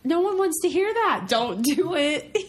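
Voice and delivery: high playful scolding. Real, not acted. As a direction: tell him off, half laughing. Scolding partner for eating.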